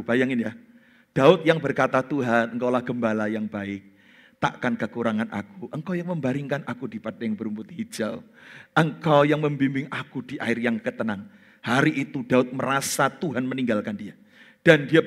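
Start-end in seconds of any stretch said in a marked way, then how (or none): 2.0-11.3 softer, lower, trusting prayer; tender psalm. Pretend. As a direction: speak it like a quiet trusting prayer. David's trusting psalm quoted. (lirih dan penuh percaya, seperti doa yang lembut)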